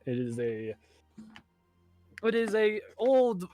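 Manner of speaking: usual speaking voice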